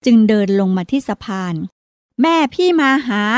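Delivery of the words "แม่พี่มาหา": put-on high, slow child call; shouting again. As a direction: call him once more, drawn out. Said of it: "ประภาตะโกนบอกอีกสองสามรอบ". (เรียกพี่ซ้ำเสียงสูงช้าๆ)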